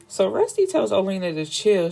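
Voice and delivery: ordinary talk, nothing marked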